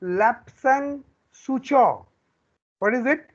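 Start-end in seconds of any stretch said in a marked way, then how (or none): 0.0-2.1 Lapsang Souchong said slowly with a gap between the words; pronunciation model. Shown as a demonstration. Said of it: "Can you pronounce it". ("Lapsang Souchong" धीरे-धीरे, अलग-अलग शब्दों में)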